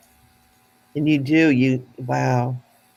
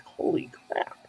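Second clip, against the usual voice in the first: breathy whispered holy crap, much softer; hushed inner reaction; whispered aside; 9 dB quieter than usual. Retold or imitated. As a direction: whisper it like a private gasp of dismay. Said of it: "there's part of me that's like".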